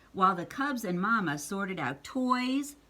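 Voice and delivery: ordinary delivery, nothing marked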